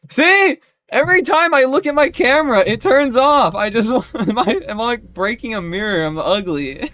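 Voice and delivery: high, very loud indignant outburst; exasperated shout. Real, not acted. Streamer whose camera turns off when looked at, exasperated shouting. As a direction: shout in disbelief, breaking into a laugh near the end.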